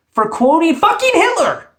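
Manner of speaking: high, loud burst of disbelief; outraged outburst. Real, not acted. Host ranting at player who quoted Hitler, outraged. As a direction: cry it out in real outrage.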